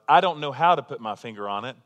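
ordinary talk

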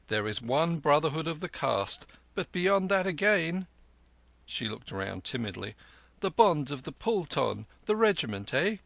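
a flirt sweet-talking the soldiers; lightly voiced courtesan.

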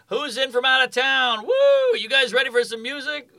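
high-pitched mock emcee patter; rally a crowd, pitched well up; hyping the crowd. Hyping a crowd onstage, retold.

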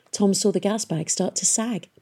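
usual speaking voice

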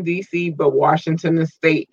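normal talking voice

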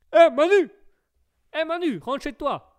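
high shouted sketch call; Les Inconnus sketch impression. Streamer replays a comedy sketch to viewers, hollered high. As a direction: holler like a comic hailing a pal. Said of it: "c'est les inconnus".

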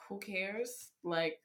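ordinary talk, nothing marked

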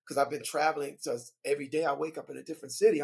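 ordinary talk, nothing marked

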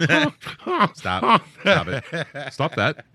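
giggling through the words; laughing while begging stop. Real, not acted. Laughing while telling him stop.

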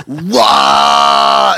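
loud, held exorcist-style roar; possessed scream. Retold or imitated. Bandmate acting out a girl projectile vomiting, possessed scream. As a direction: mimic a possessed girl's long wail. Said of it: "possessed and like an exorcist".

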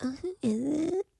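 high, airy mock-innocent voice; mock airhead. Friend mocks Zara pretending not to know, high airy voice. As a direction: play the fake-clueless girl.

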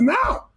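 Now said sharply high as a firm command; emphatic now. Retold or imitated. Retelling telling his daughter to call at once, with Now said sharply high. Father insists she call immediately.

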